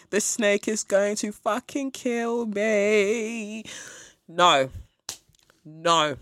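slow mock-despairing wail; mock terror. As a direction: moan it in pretend doom. Pretend terror in cellar.

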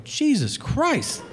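high, drawn-out disbelief; appalled. Retold or imitated. Caller reacts to father writing off his son, appalled. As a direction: stretch it out, aghast at the cruelty.

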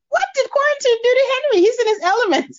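laughing, high and fast. Real, not acted. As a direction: laugh through the words. Laughing amazement.

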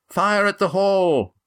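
high, drawn-out alarm call; yell. A narrator's reading. Lindy warning students near the gate, yelled. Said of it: "yelled Lindy".

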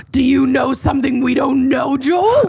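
high, dramatic accusing question; hammed-up scandalized questioning; mock suspicion. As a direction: climb to a high rise on the name. Mock suspicion after a slip.

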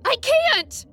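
high, piercing cry of panic; hysterical yell. Acted. Katie yelling at her friend, hysterical. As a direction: scream it, losing control. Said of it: "she yelled hysterically".

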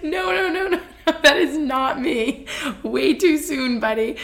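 high, laughing string of refusals; laughing protest. Retold or imitated. Acting out flustered denial, laughing.

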